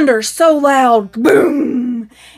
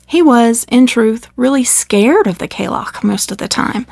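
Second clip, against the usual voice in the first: ordinary delivery, nothing marked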